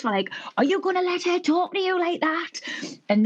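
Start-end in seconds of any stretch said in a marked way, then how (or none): 0.6-2.5 high-pitched mock outrage; retold indignant challenge, pitched way up; indignant egging-on. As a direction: imitate scandalised mates goading a friend. Mates egging her on in quarrel.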